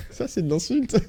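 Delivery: high voice breaking into laughter; amused, laughing through the words; real laughing. Real, not acted. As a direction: crack up while getting the words out. Cracks up at the game's insult.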